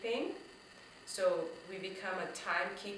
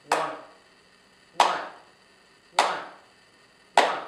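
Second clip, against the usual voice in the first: ONE on each clap, evenly spaced; one-beat count; 3 semitones lower, 13 dB louder than usual. Shown as a demonstration. Teacher demonstrating one syllable, steady clapped count of ONE. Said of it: "So we can say".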